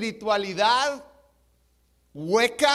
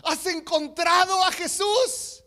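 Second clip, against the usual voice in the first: higher-pitched, drawn-out preacher's cry; excited pastor; 6 semitones higher, 5 dB louder than usual. Acted. Pastor to the dunked drunk, excited. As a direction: play a thrilled pastor calling out with long vowels. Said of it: "el pastor todo emocionado con el borrachito".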